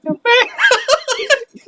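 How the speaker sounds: squealing high laugh. Real, not acted. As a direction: burst out laughing high and loud.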